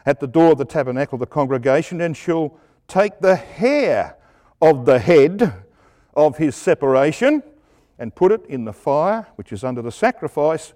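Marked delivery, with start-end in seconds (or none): none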